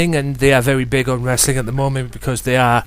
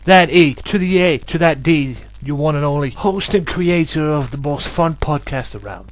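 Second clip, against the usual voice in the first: boastful; 5 semitones higher, 6 dB louder than usual. Acted. Hyped self-introduction as host.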